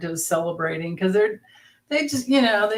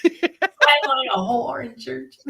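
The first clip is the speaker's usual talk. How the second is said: slow, drawn-out Southern accent; 3 semitones higher, slower than usual. Shown as a demonstration. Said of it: "How, how would you say it?".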